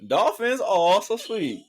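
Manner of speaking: high, slow, gushing "so sweet"; delighted squeal. Real, not acted. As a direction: melt over the dolphins. Delighted reaction to dolphin fact.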